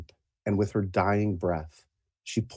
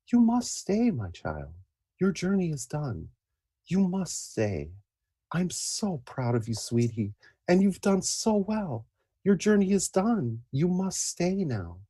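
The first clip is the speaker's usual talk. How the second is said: soft, higher loving mother; tender mother voice; 8 semitones higher, 4 dB quieter than usual. Acted. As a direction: coax her gently to stay. GM voices the dying hero's mother.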